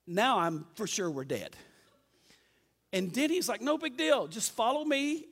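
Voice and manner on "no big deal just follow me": high, easygoing imitation of a friend; imitated carefree confidence; breezy reassurance. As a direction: wave away the danger, pitch up. Friend breezily dismisses the danger.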